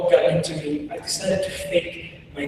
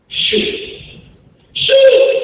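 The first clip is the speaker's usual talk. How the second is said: yelled command; 7 dB louder than usual. Retold or imitated. Soldiers yelling at a blindfolded child, command rising to a shout. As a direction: order building to a high, loud shout. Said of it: "they start yelling at me".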